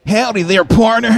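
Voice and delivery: a light southern drawl; a hint of a cowboy greeting; southern American accent. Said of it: "taste of that American accent".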